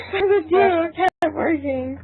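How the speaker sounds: slow, high, cracking with laughter; laughing through it. Real, not acted. Narrator reading an absurd line, dissolving into laughter. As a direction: barely get it out while laughing.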